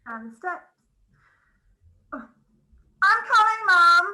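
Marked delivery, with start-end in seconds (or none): 3.0-4.1 calling out to Mum. Acted. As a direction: call out louder to someone upstairs. Maid calling to mistress.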